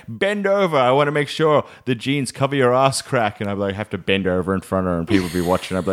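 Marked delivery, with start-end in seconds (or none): 0.1-3.4 high-pitched mock motherly order; pitched-up, matter-of-fact command; imitated bossy mum. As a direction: play mum inspecting the jeans. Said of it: "And she'd be like".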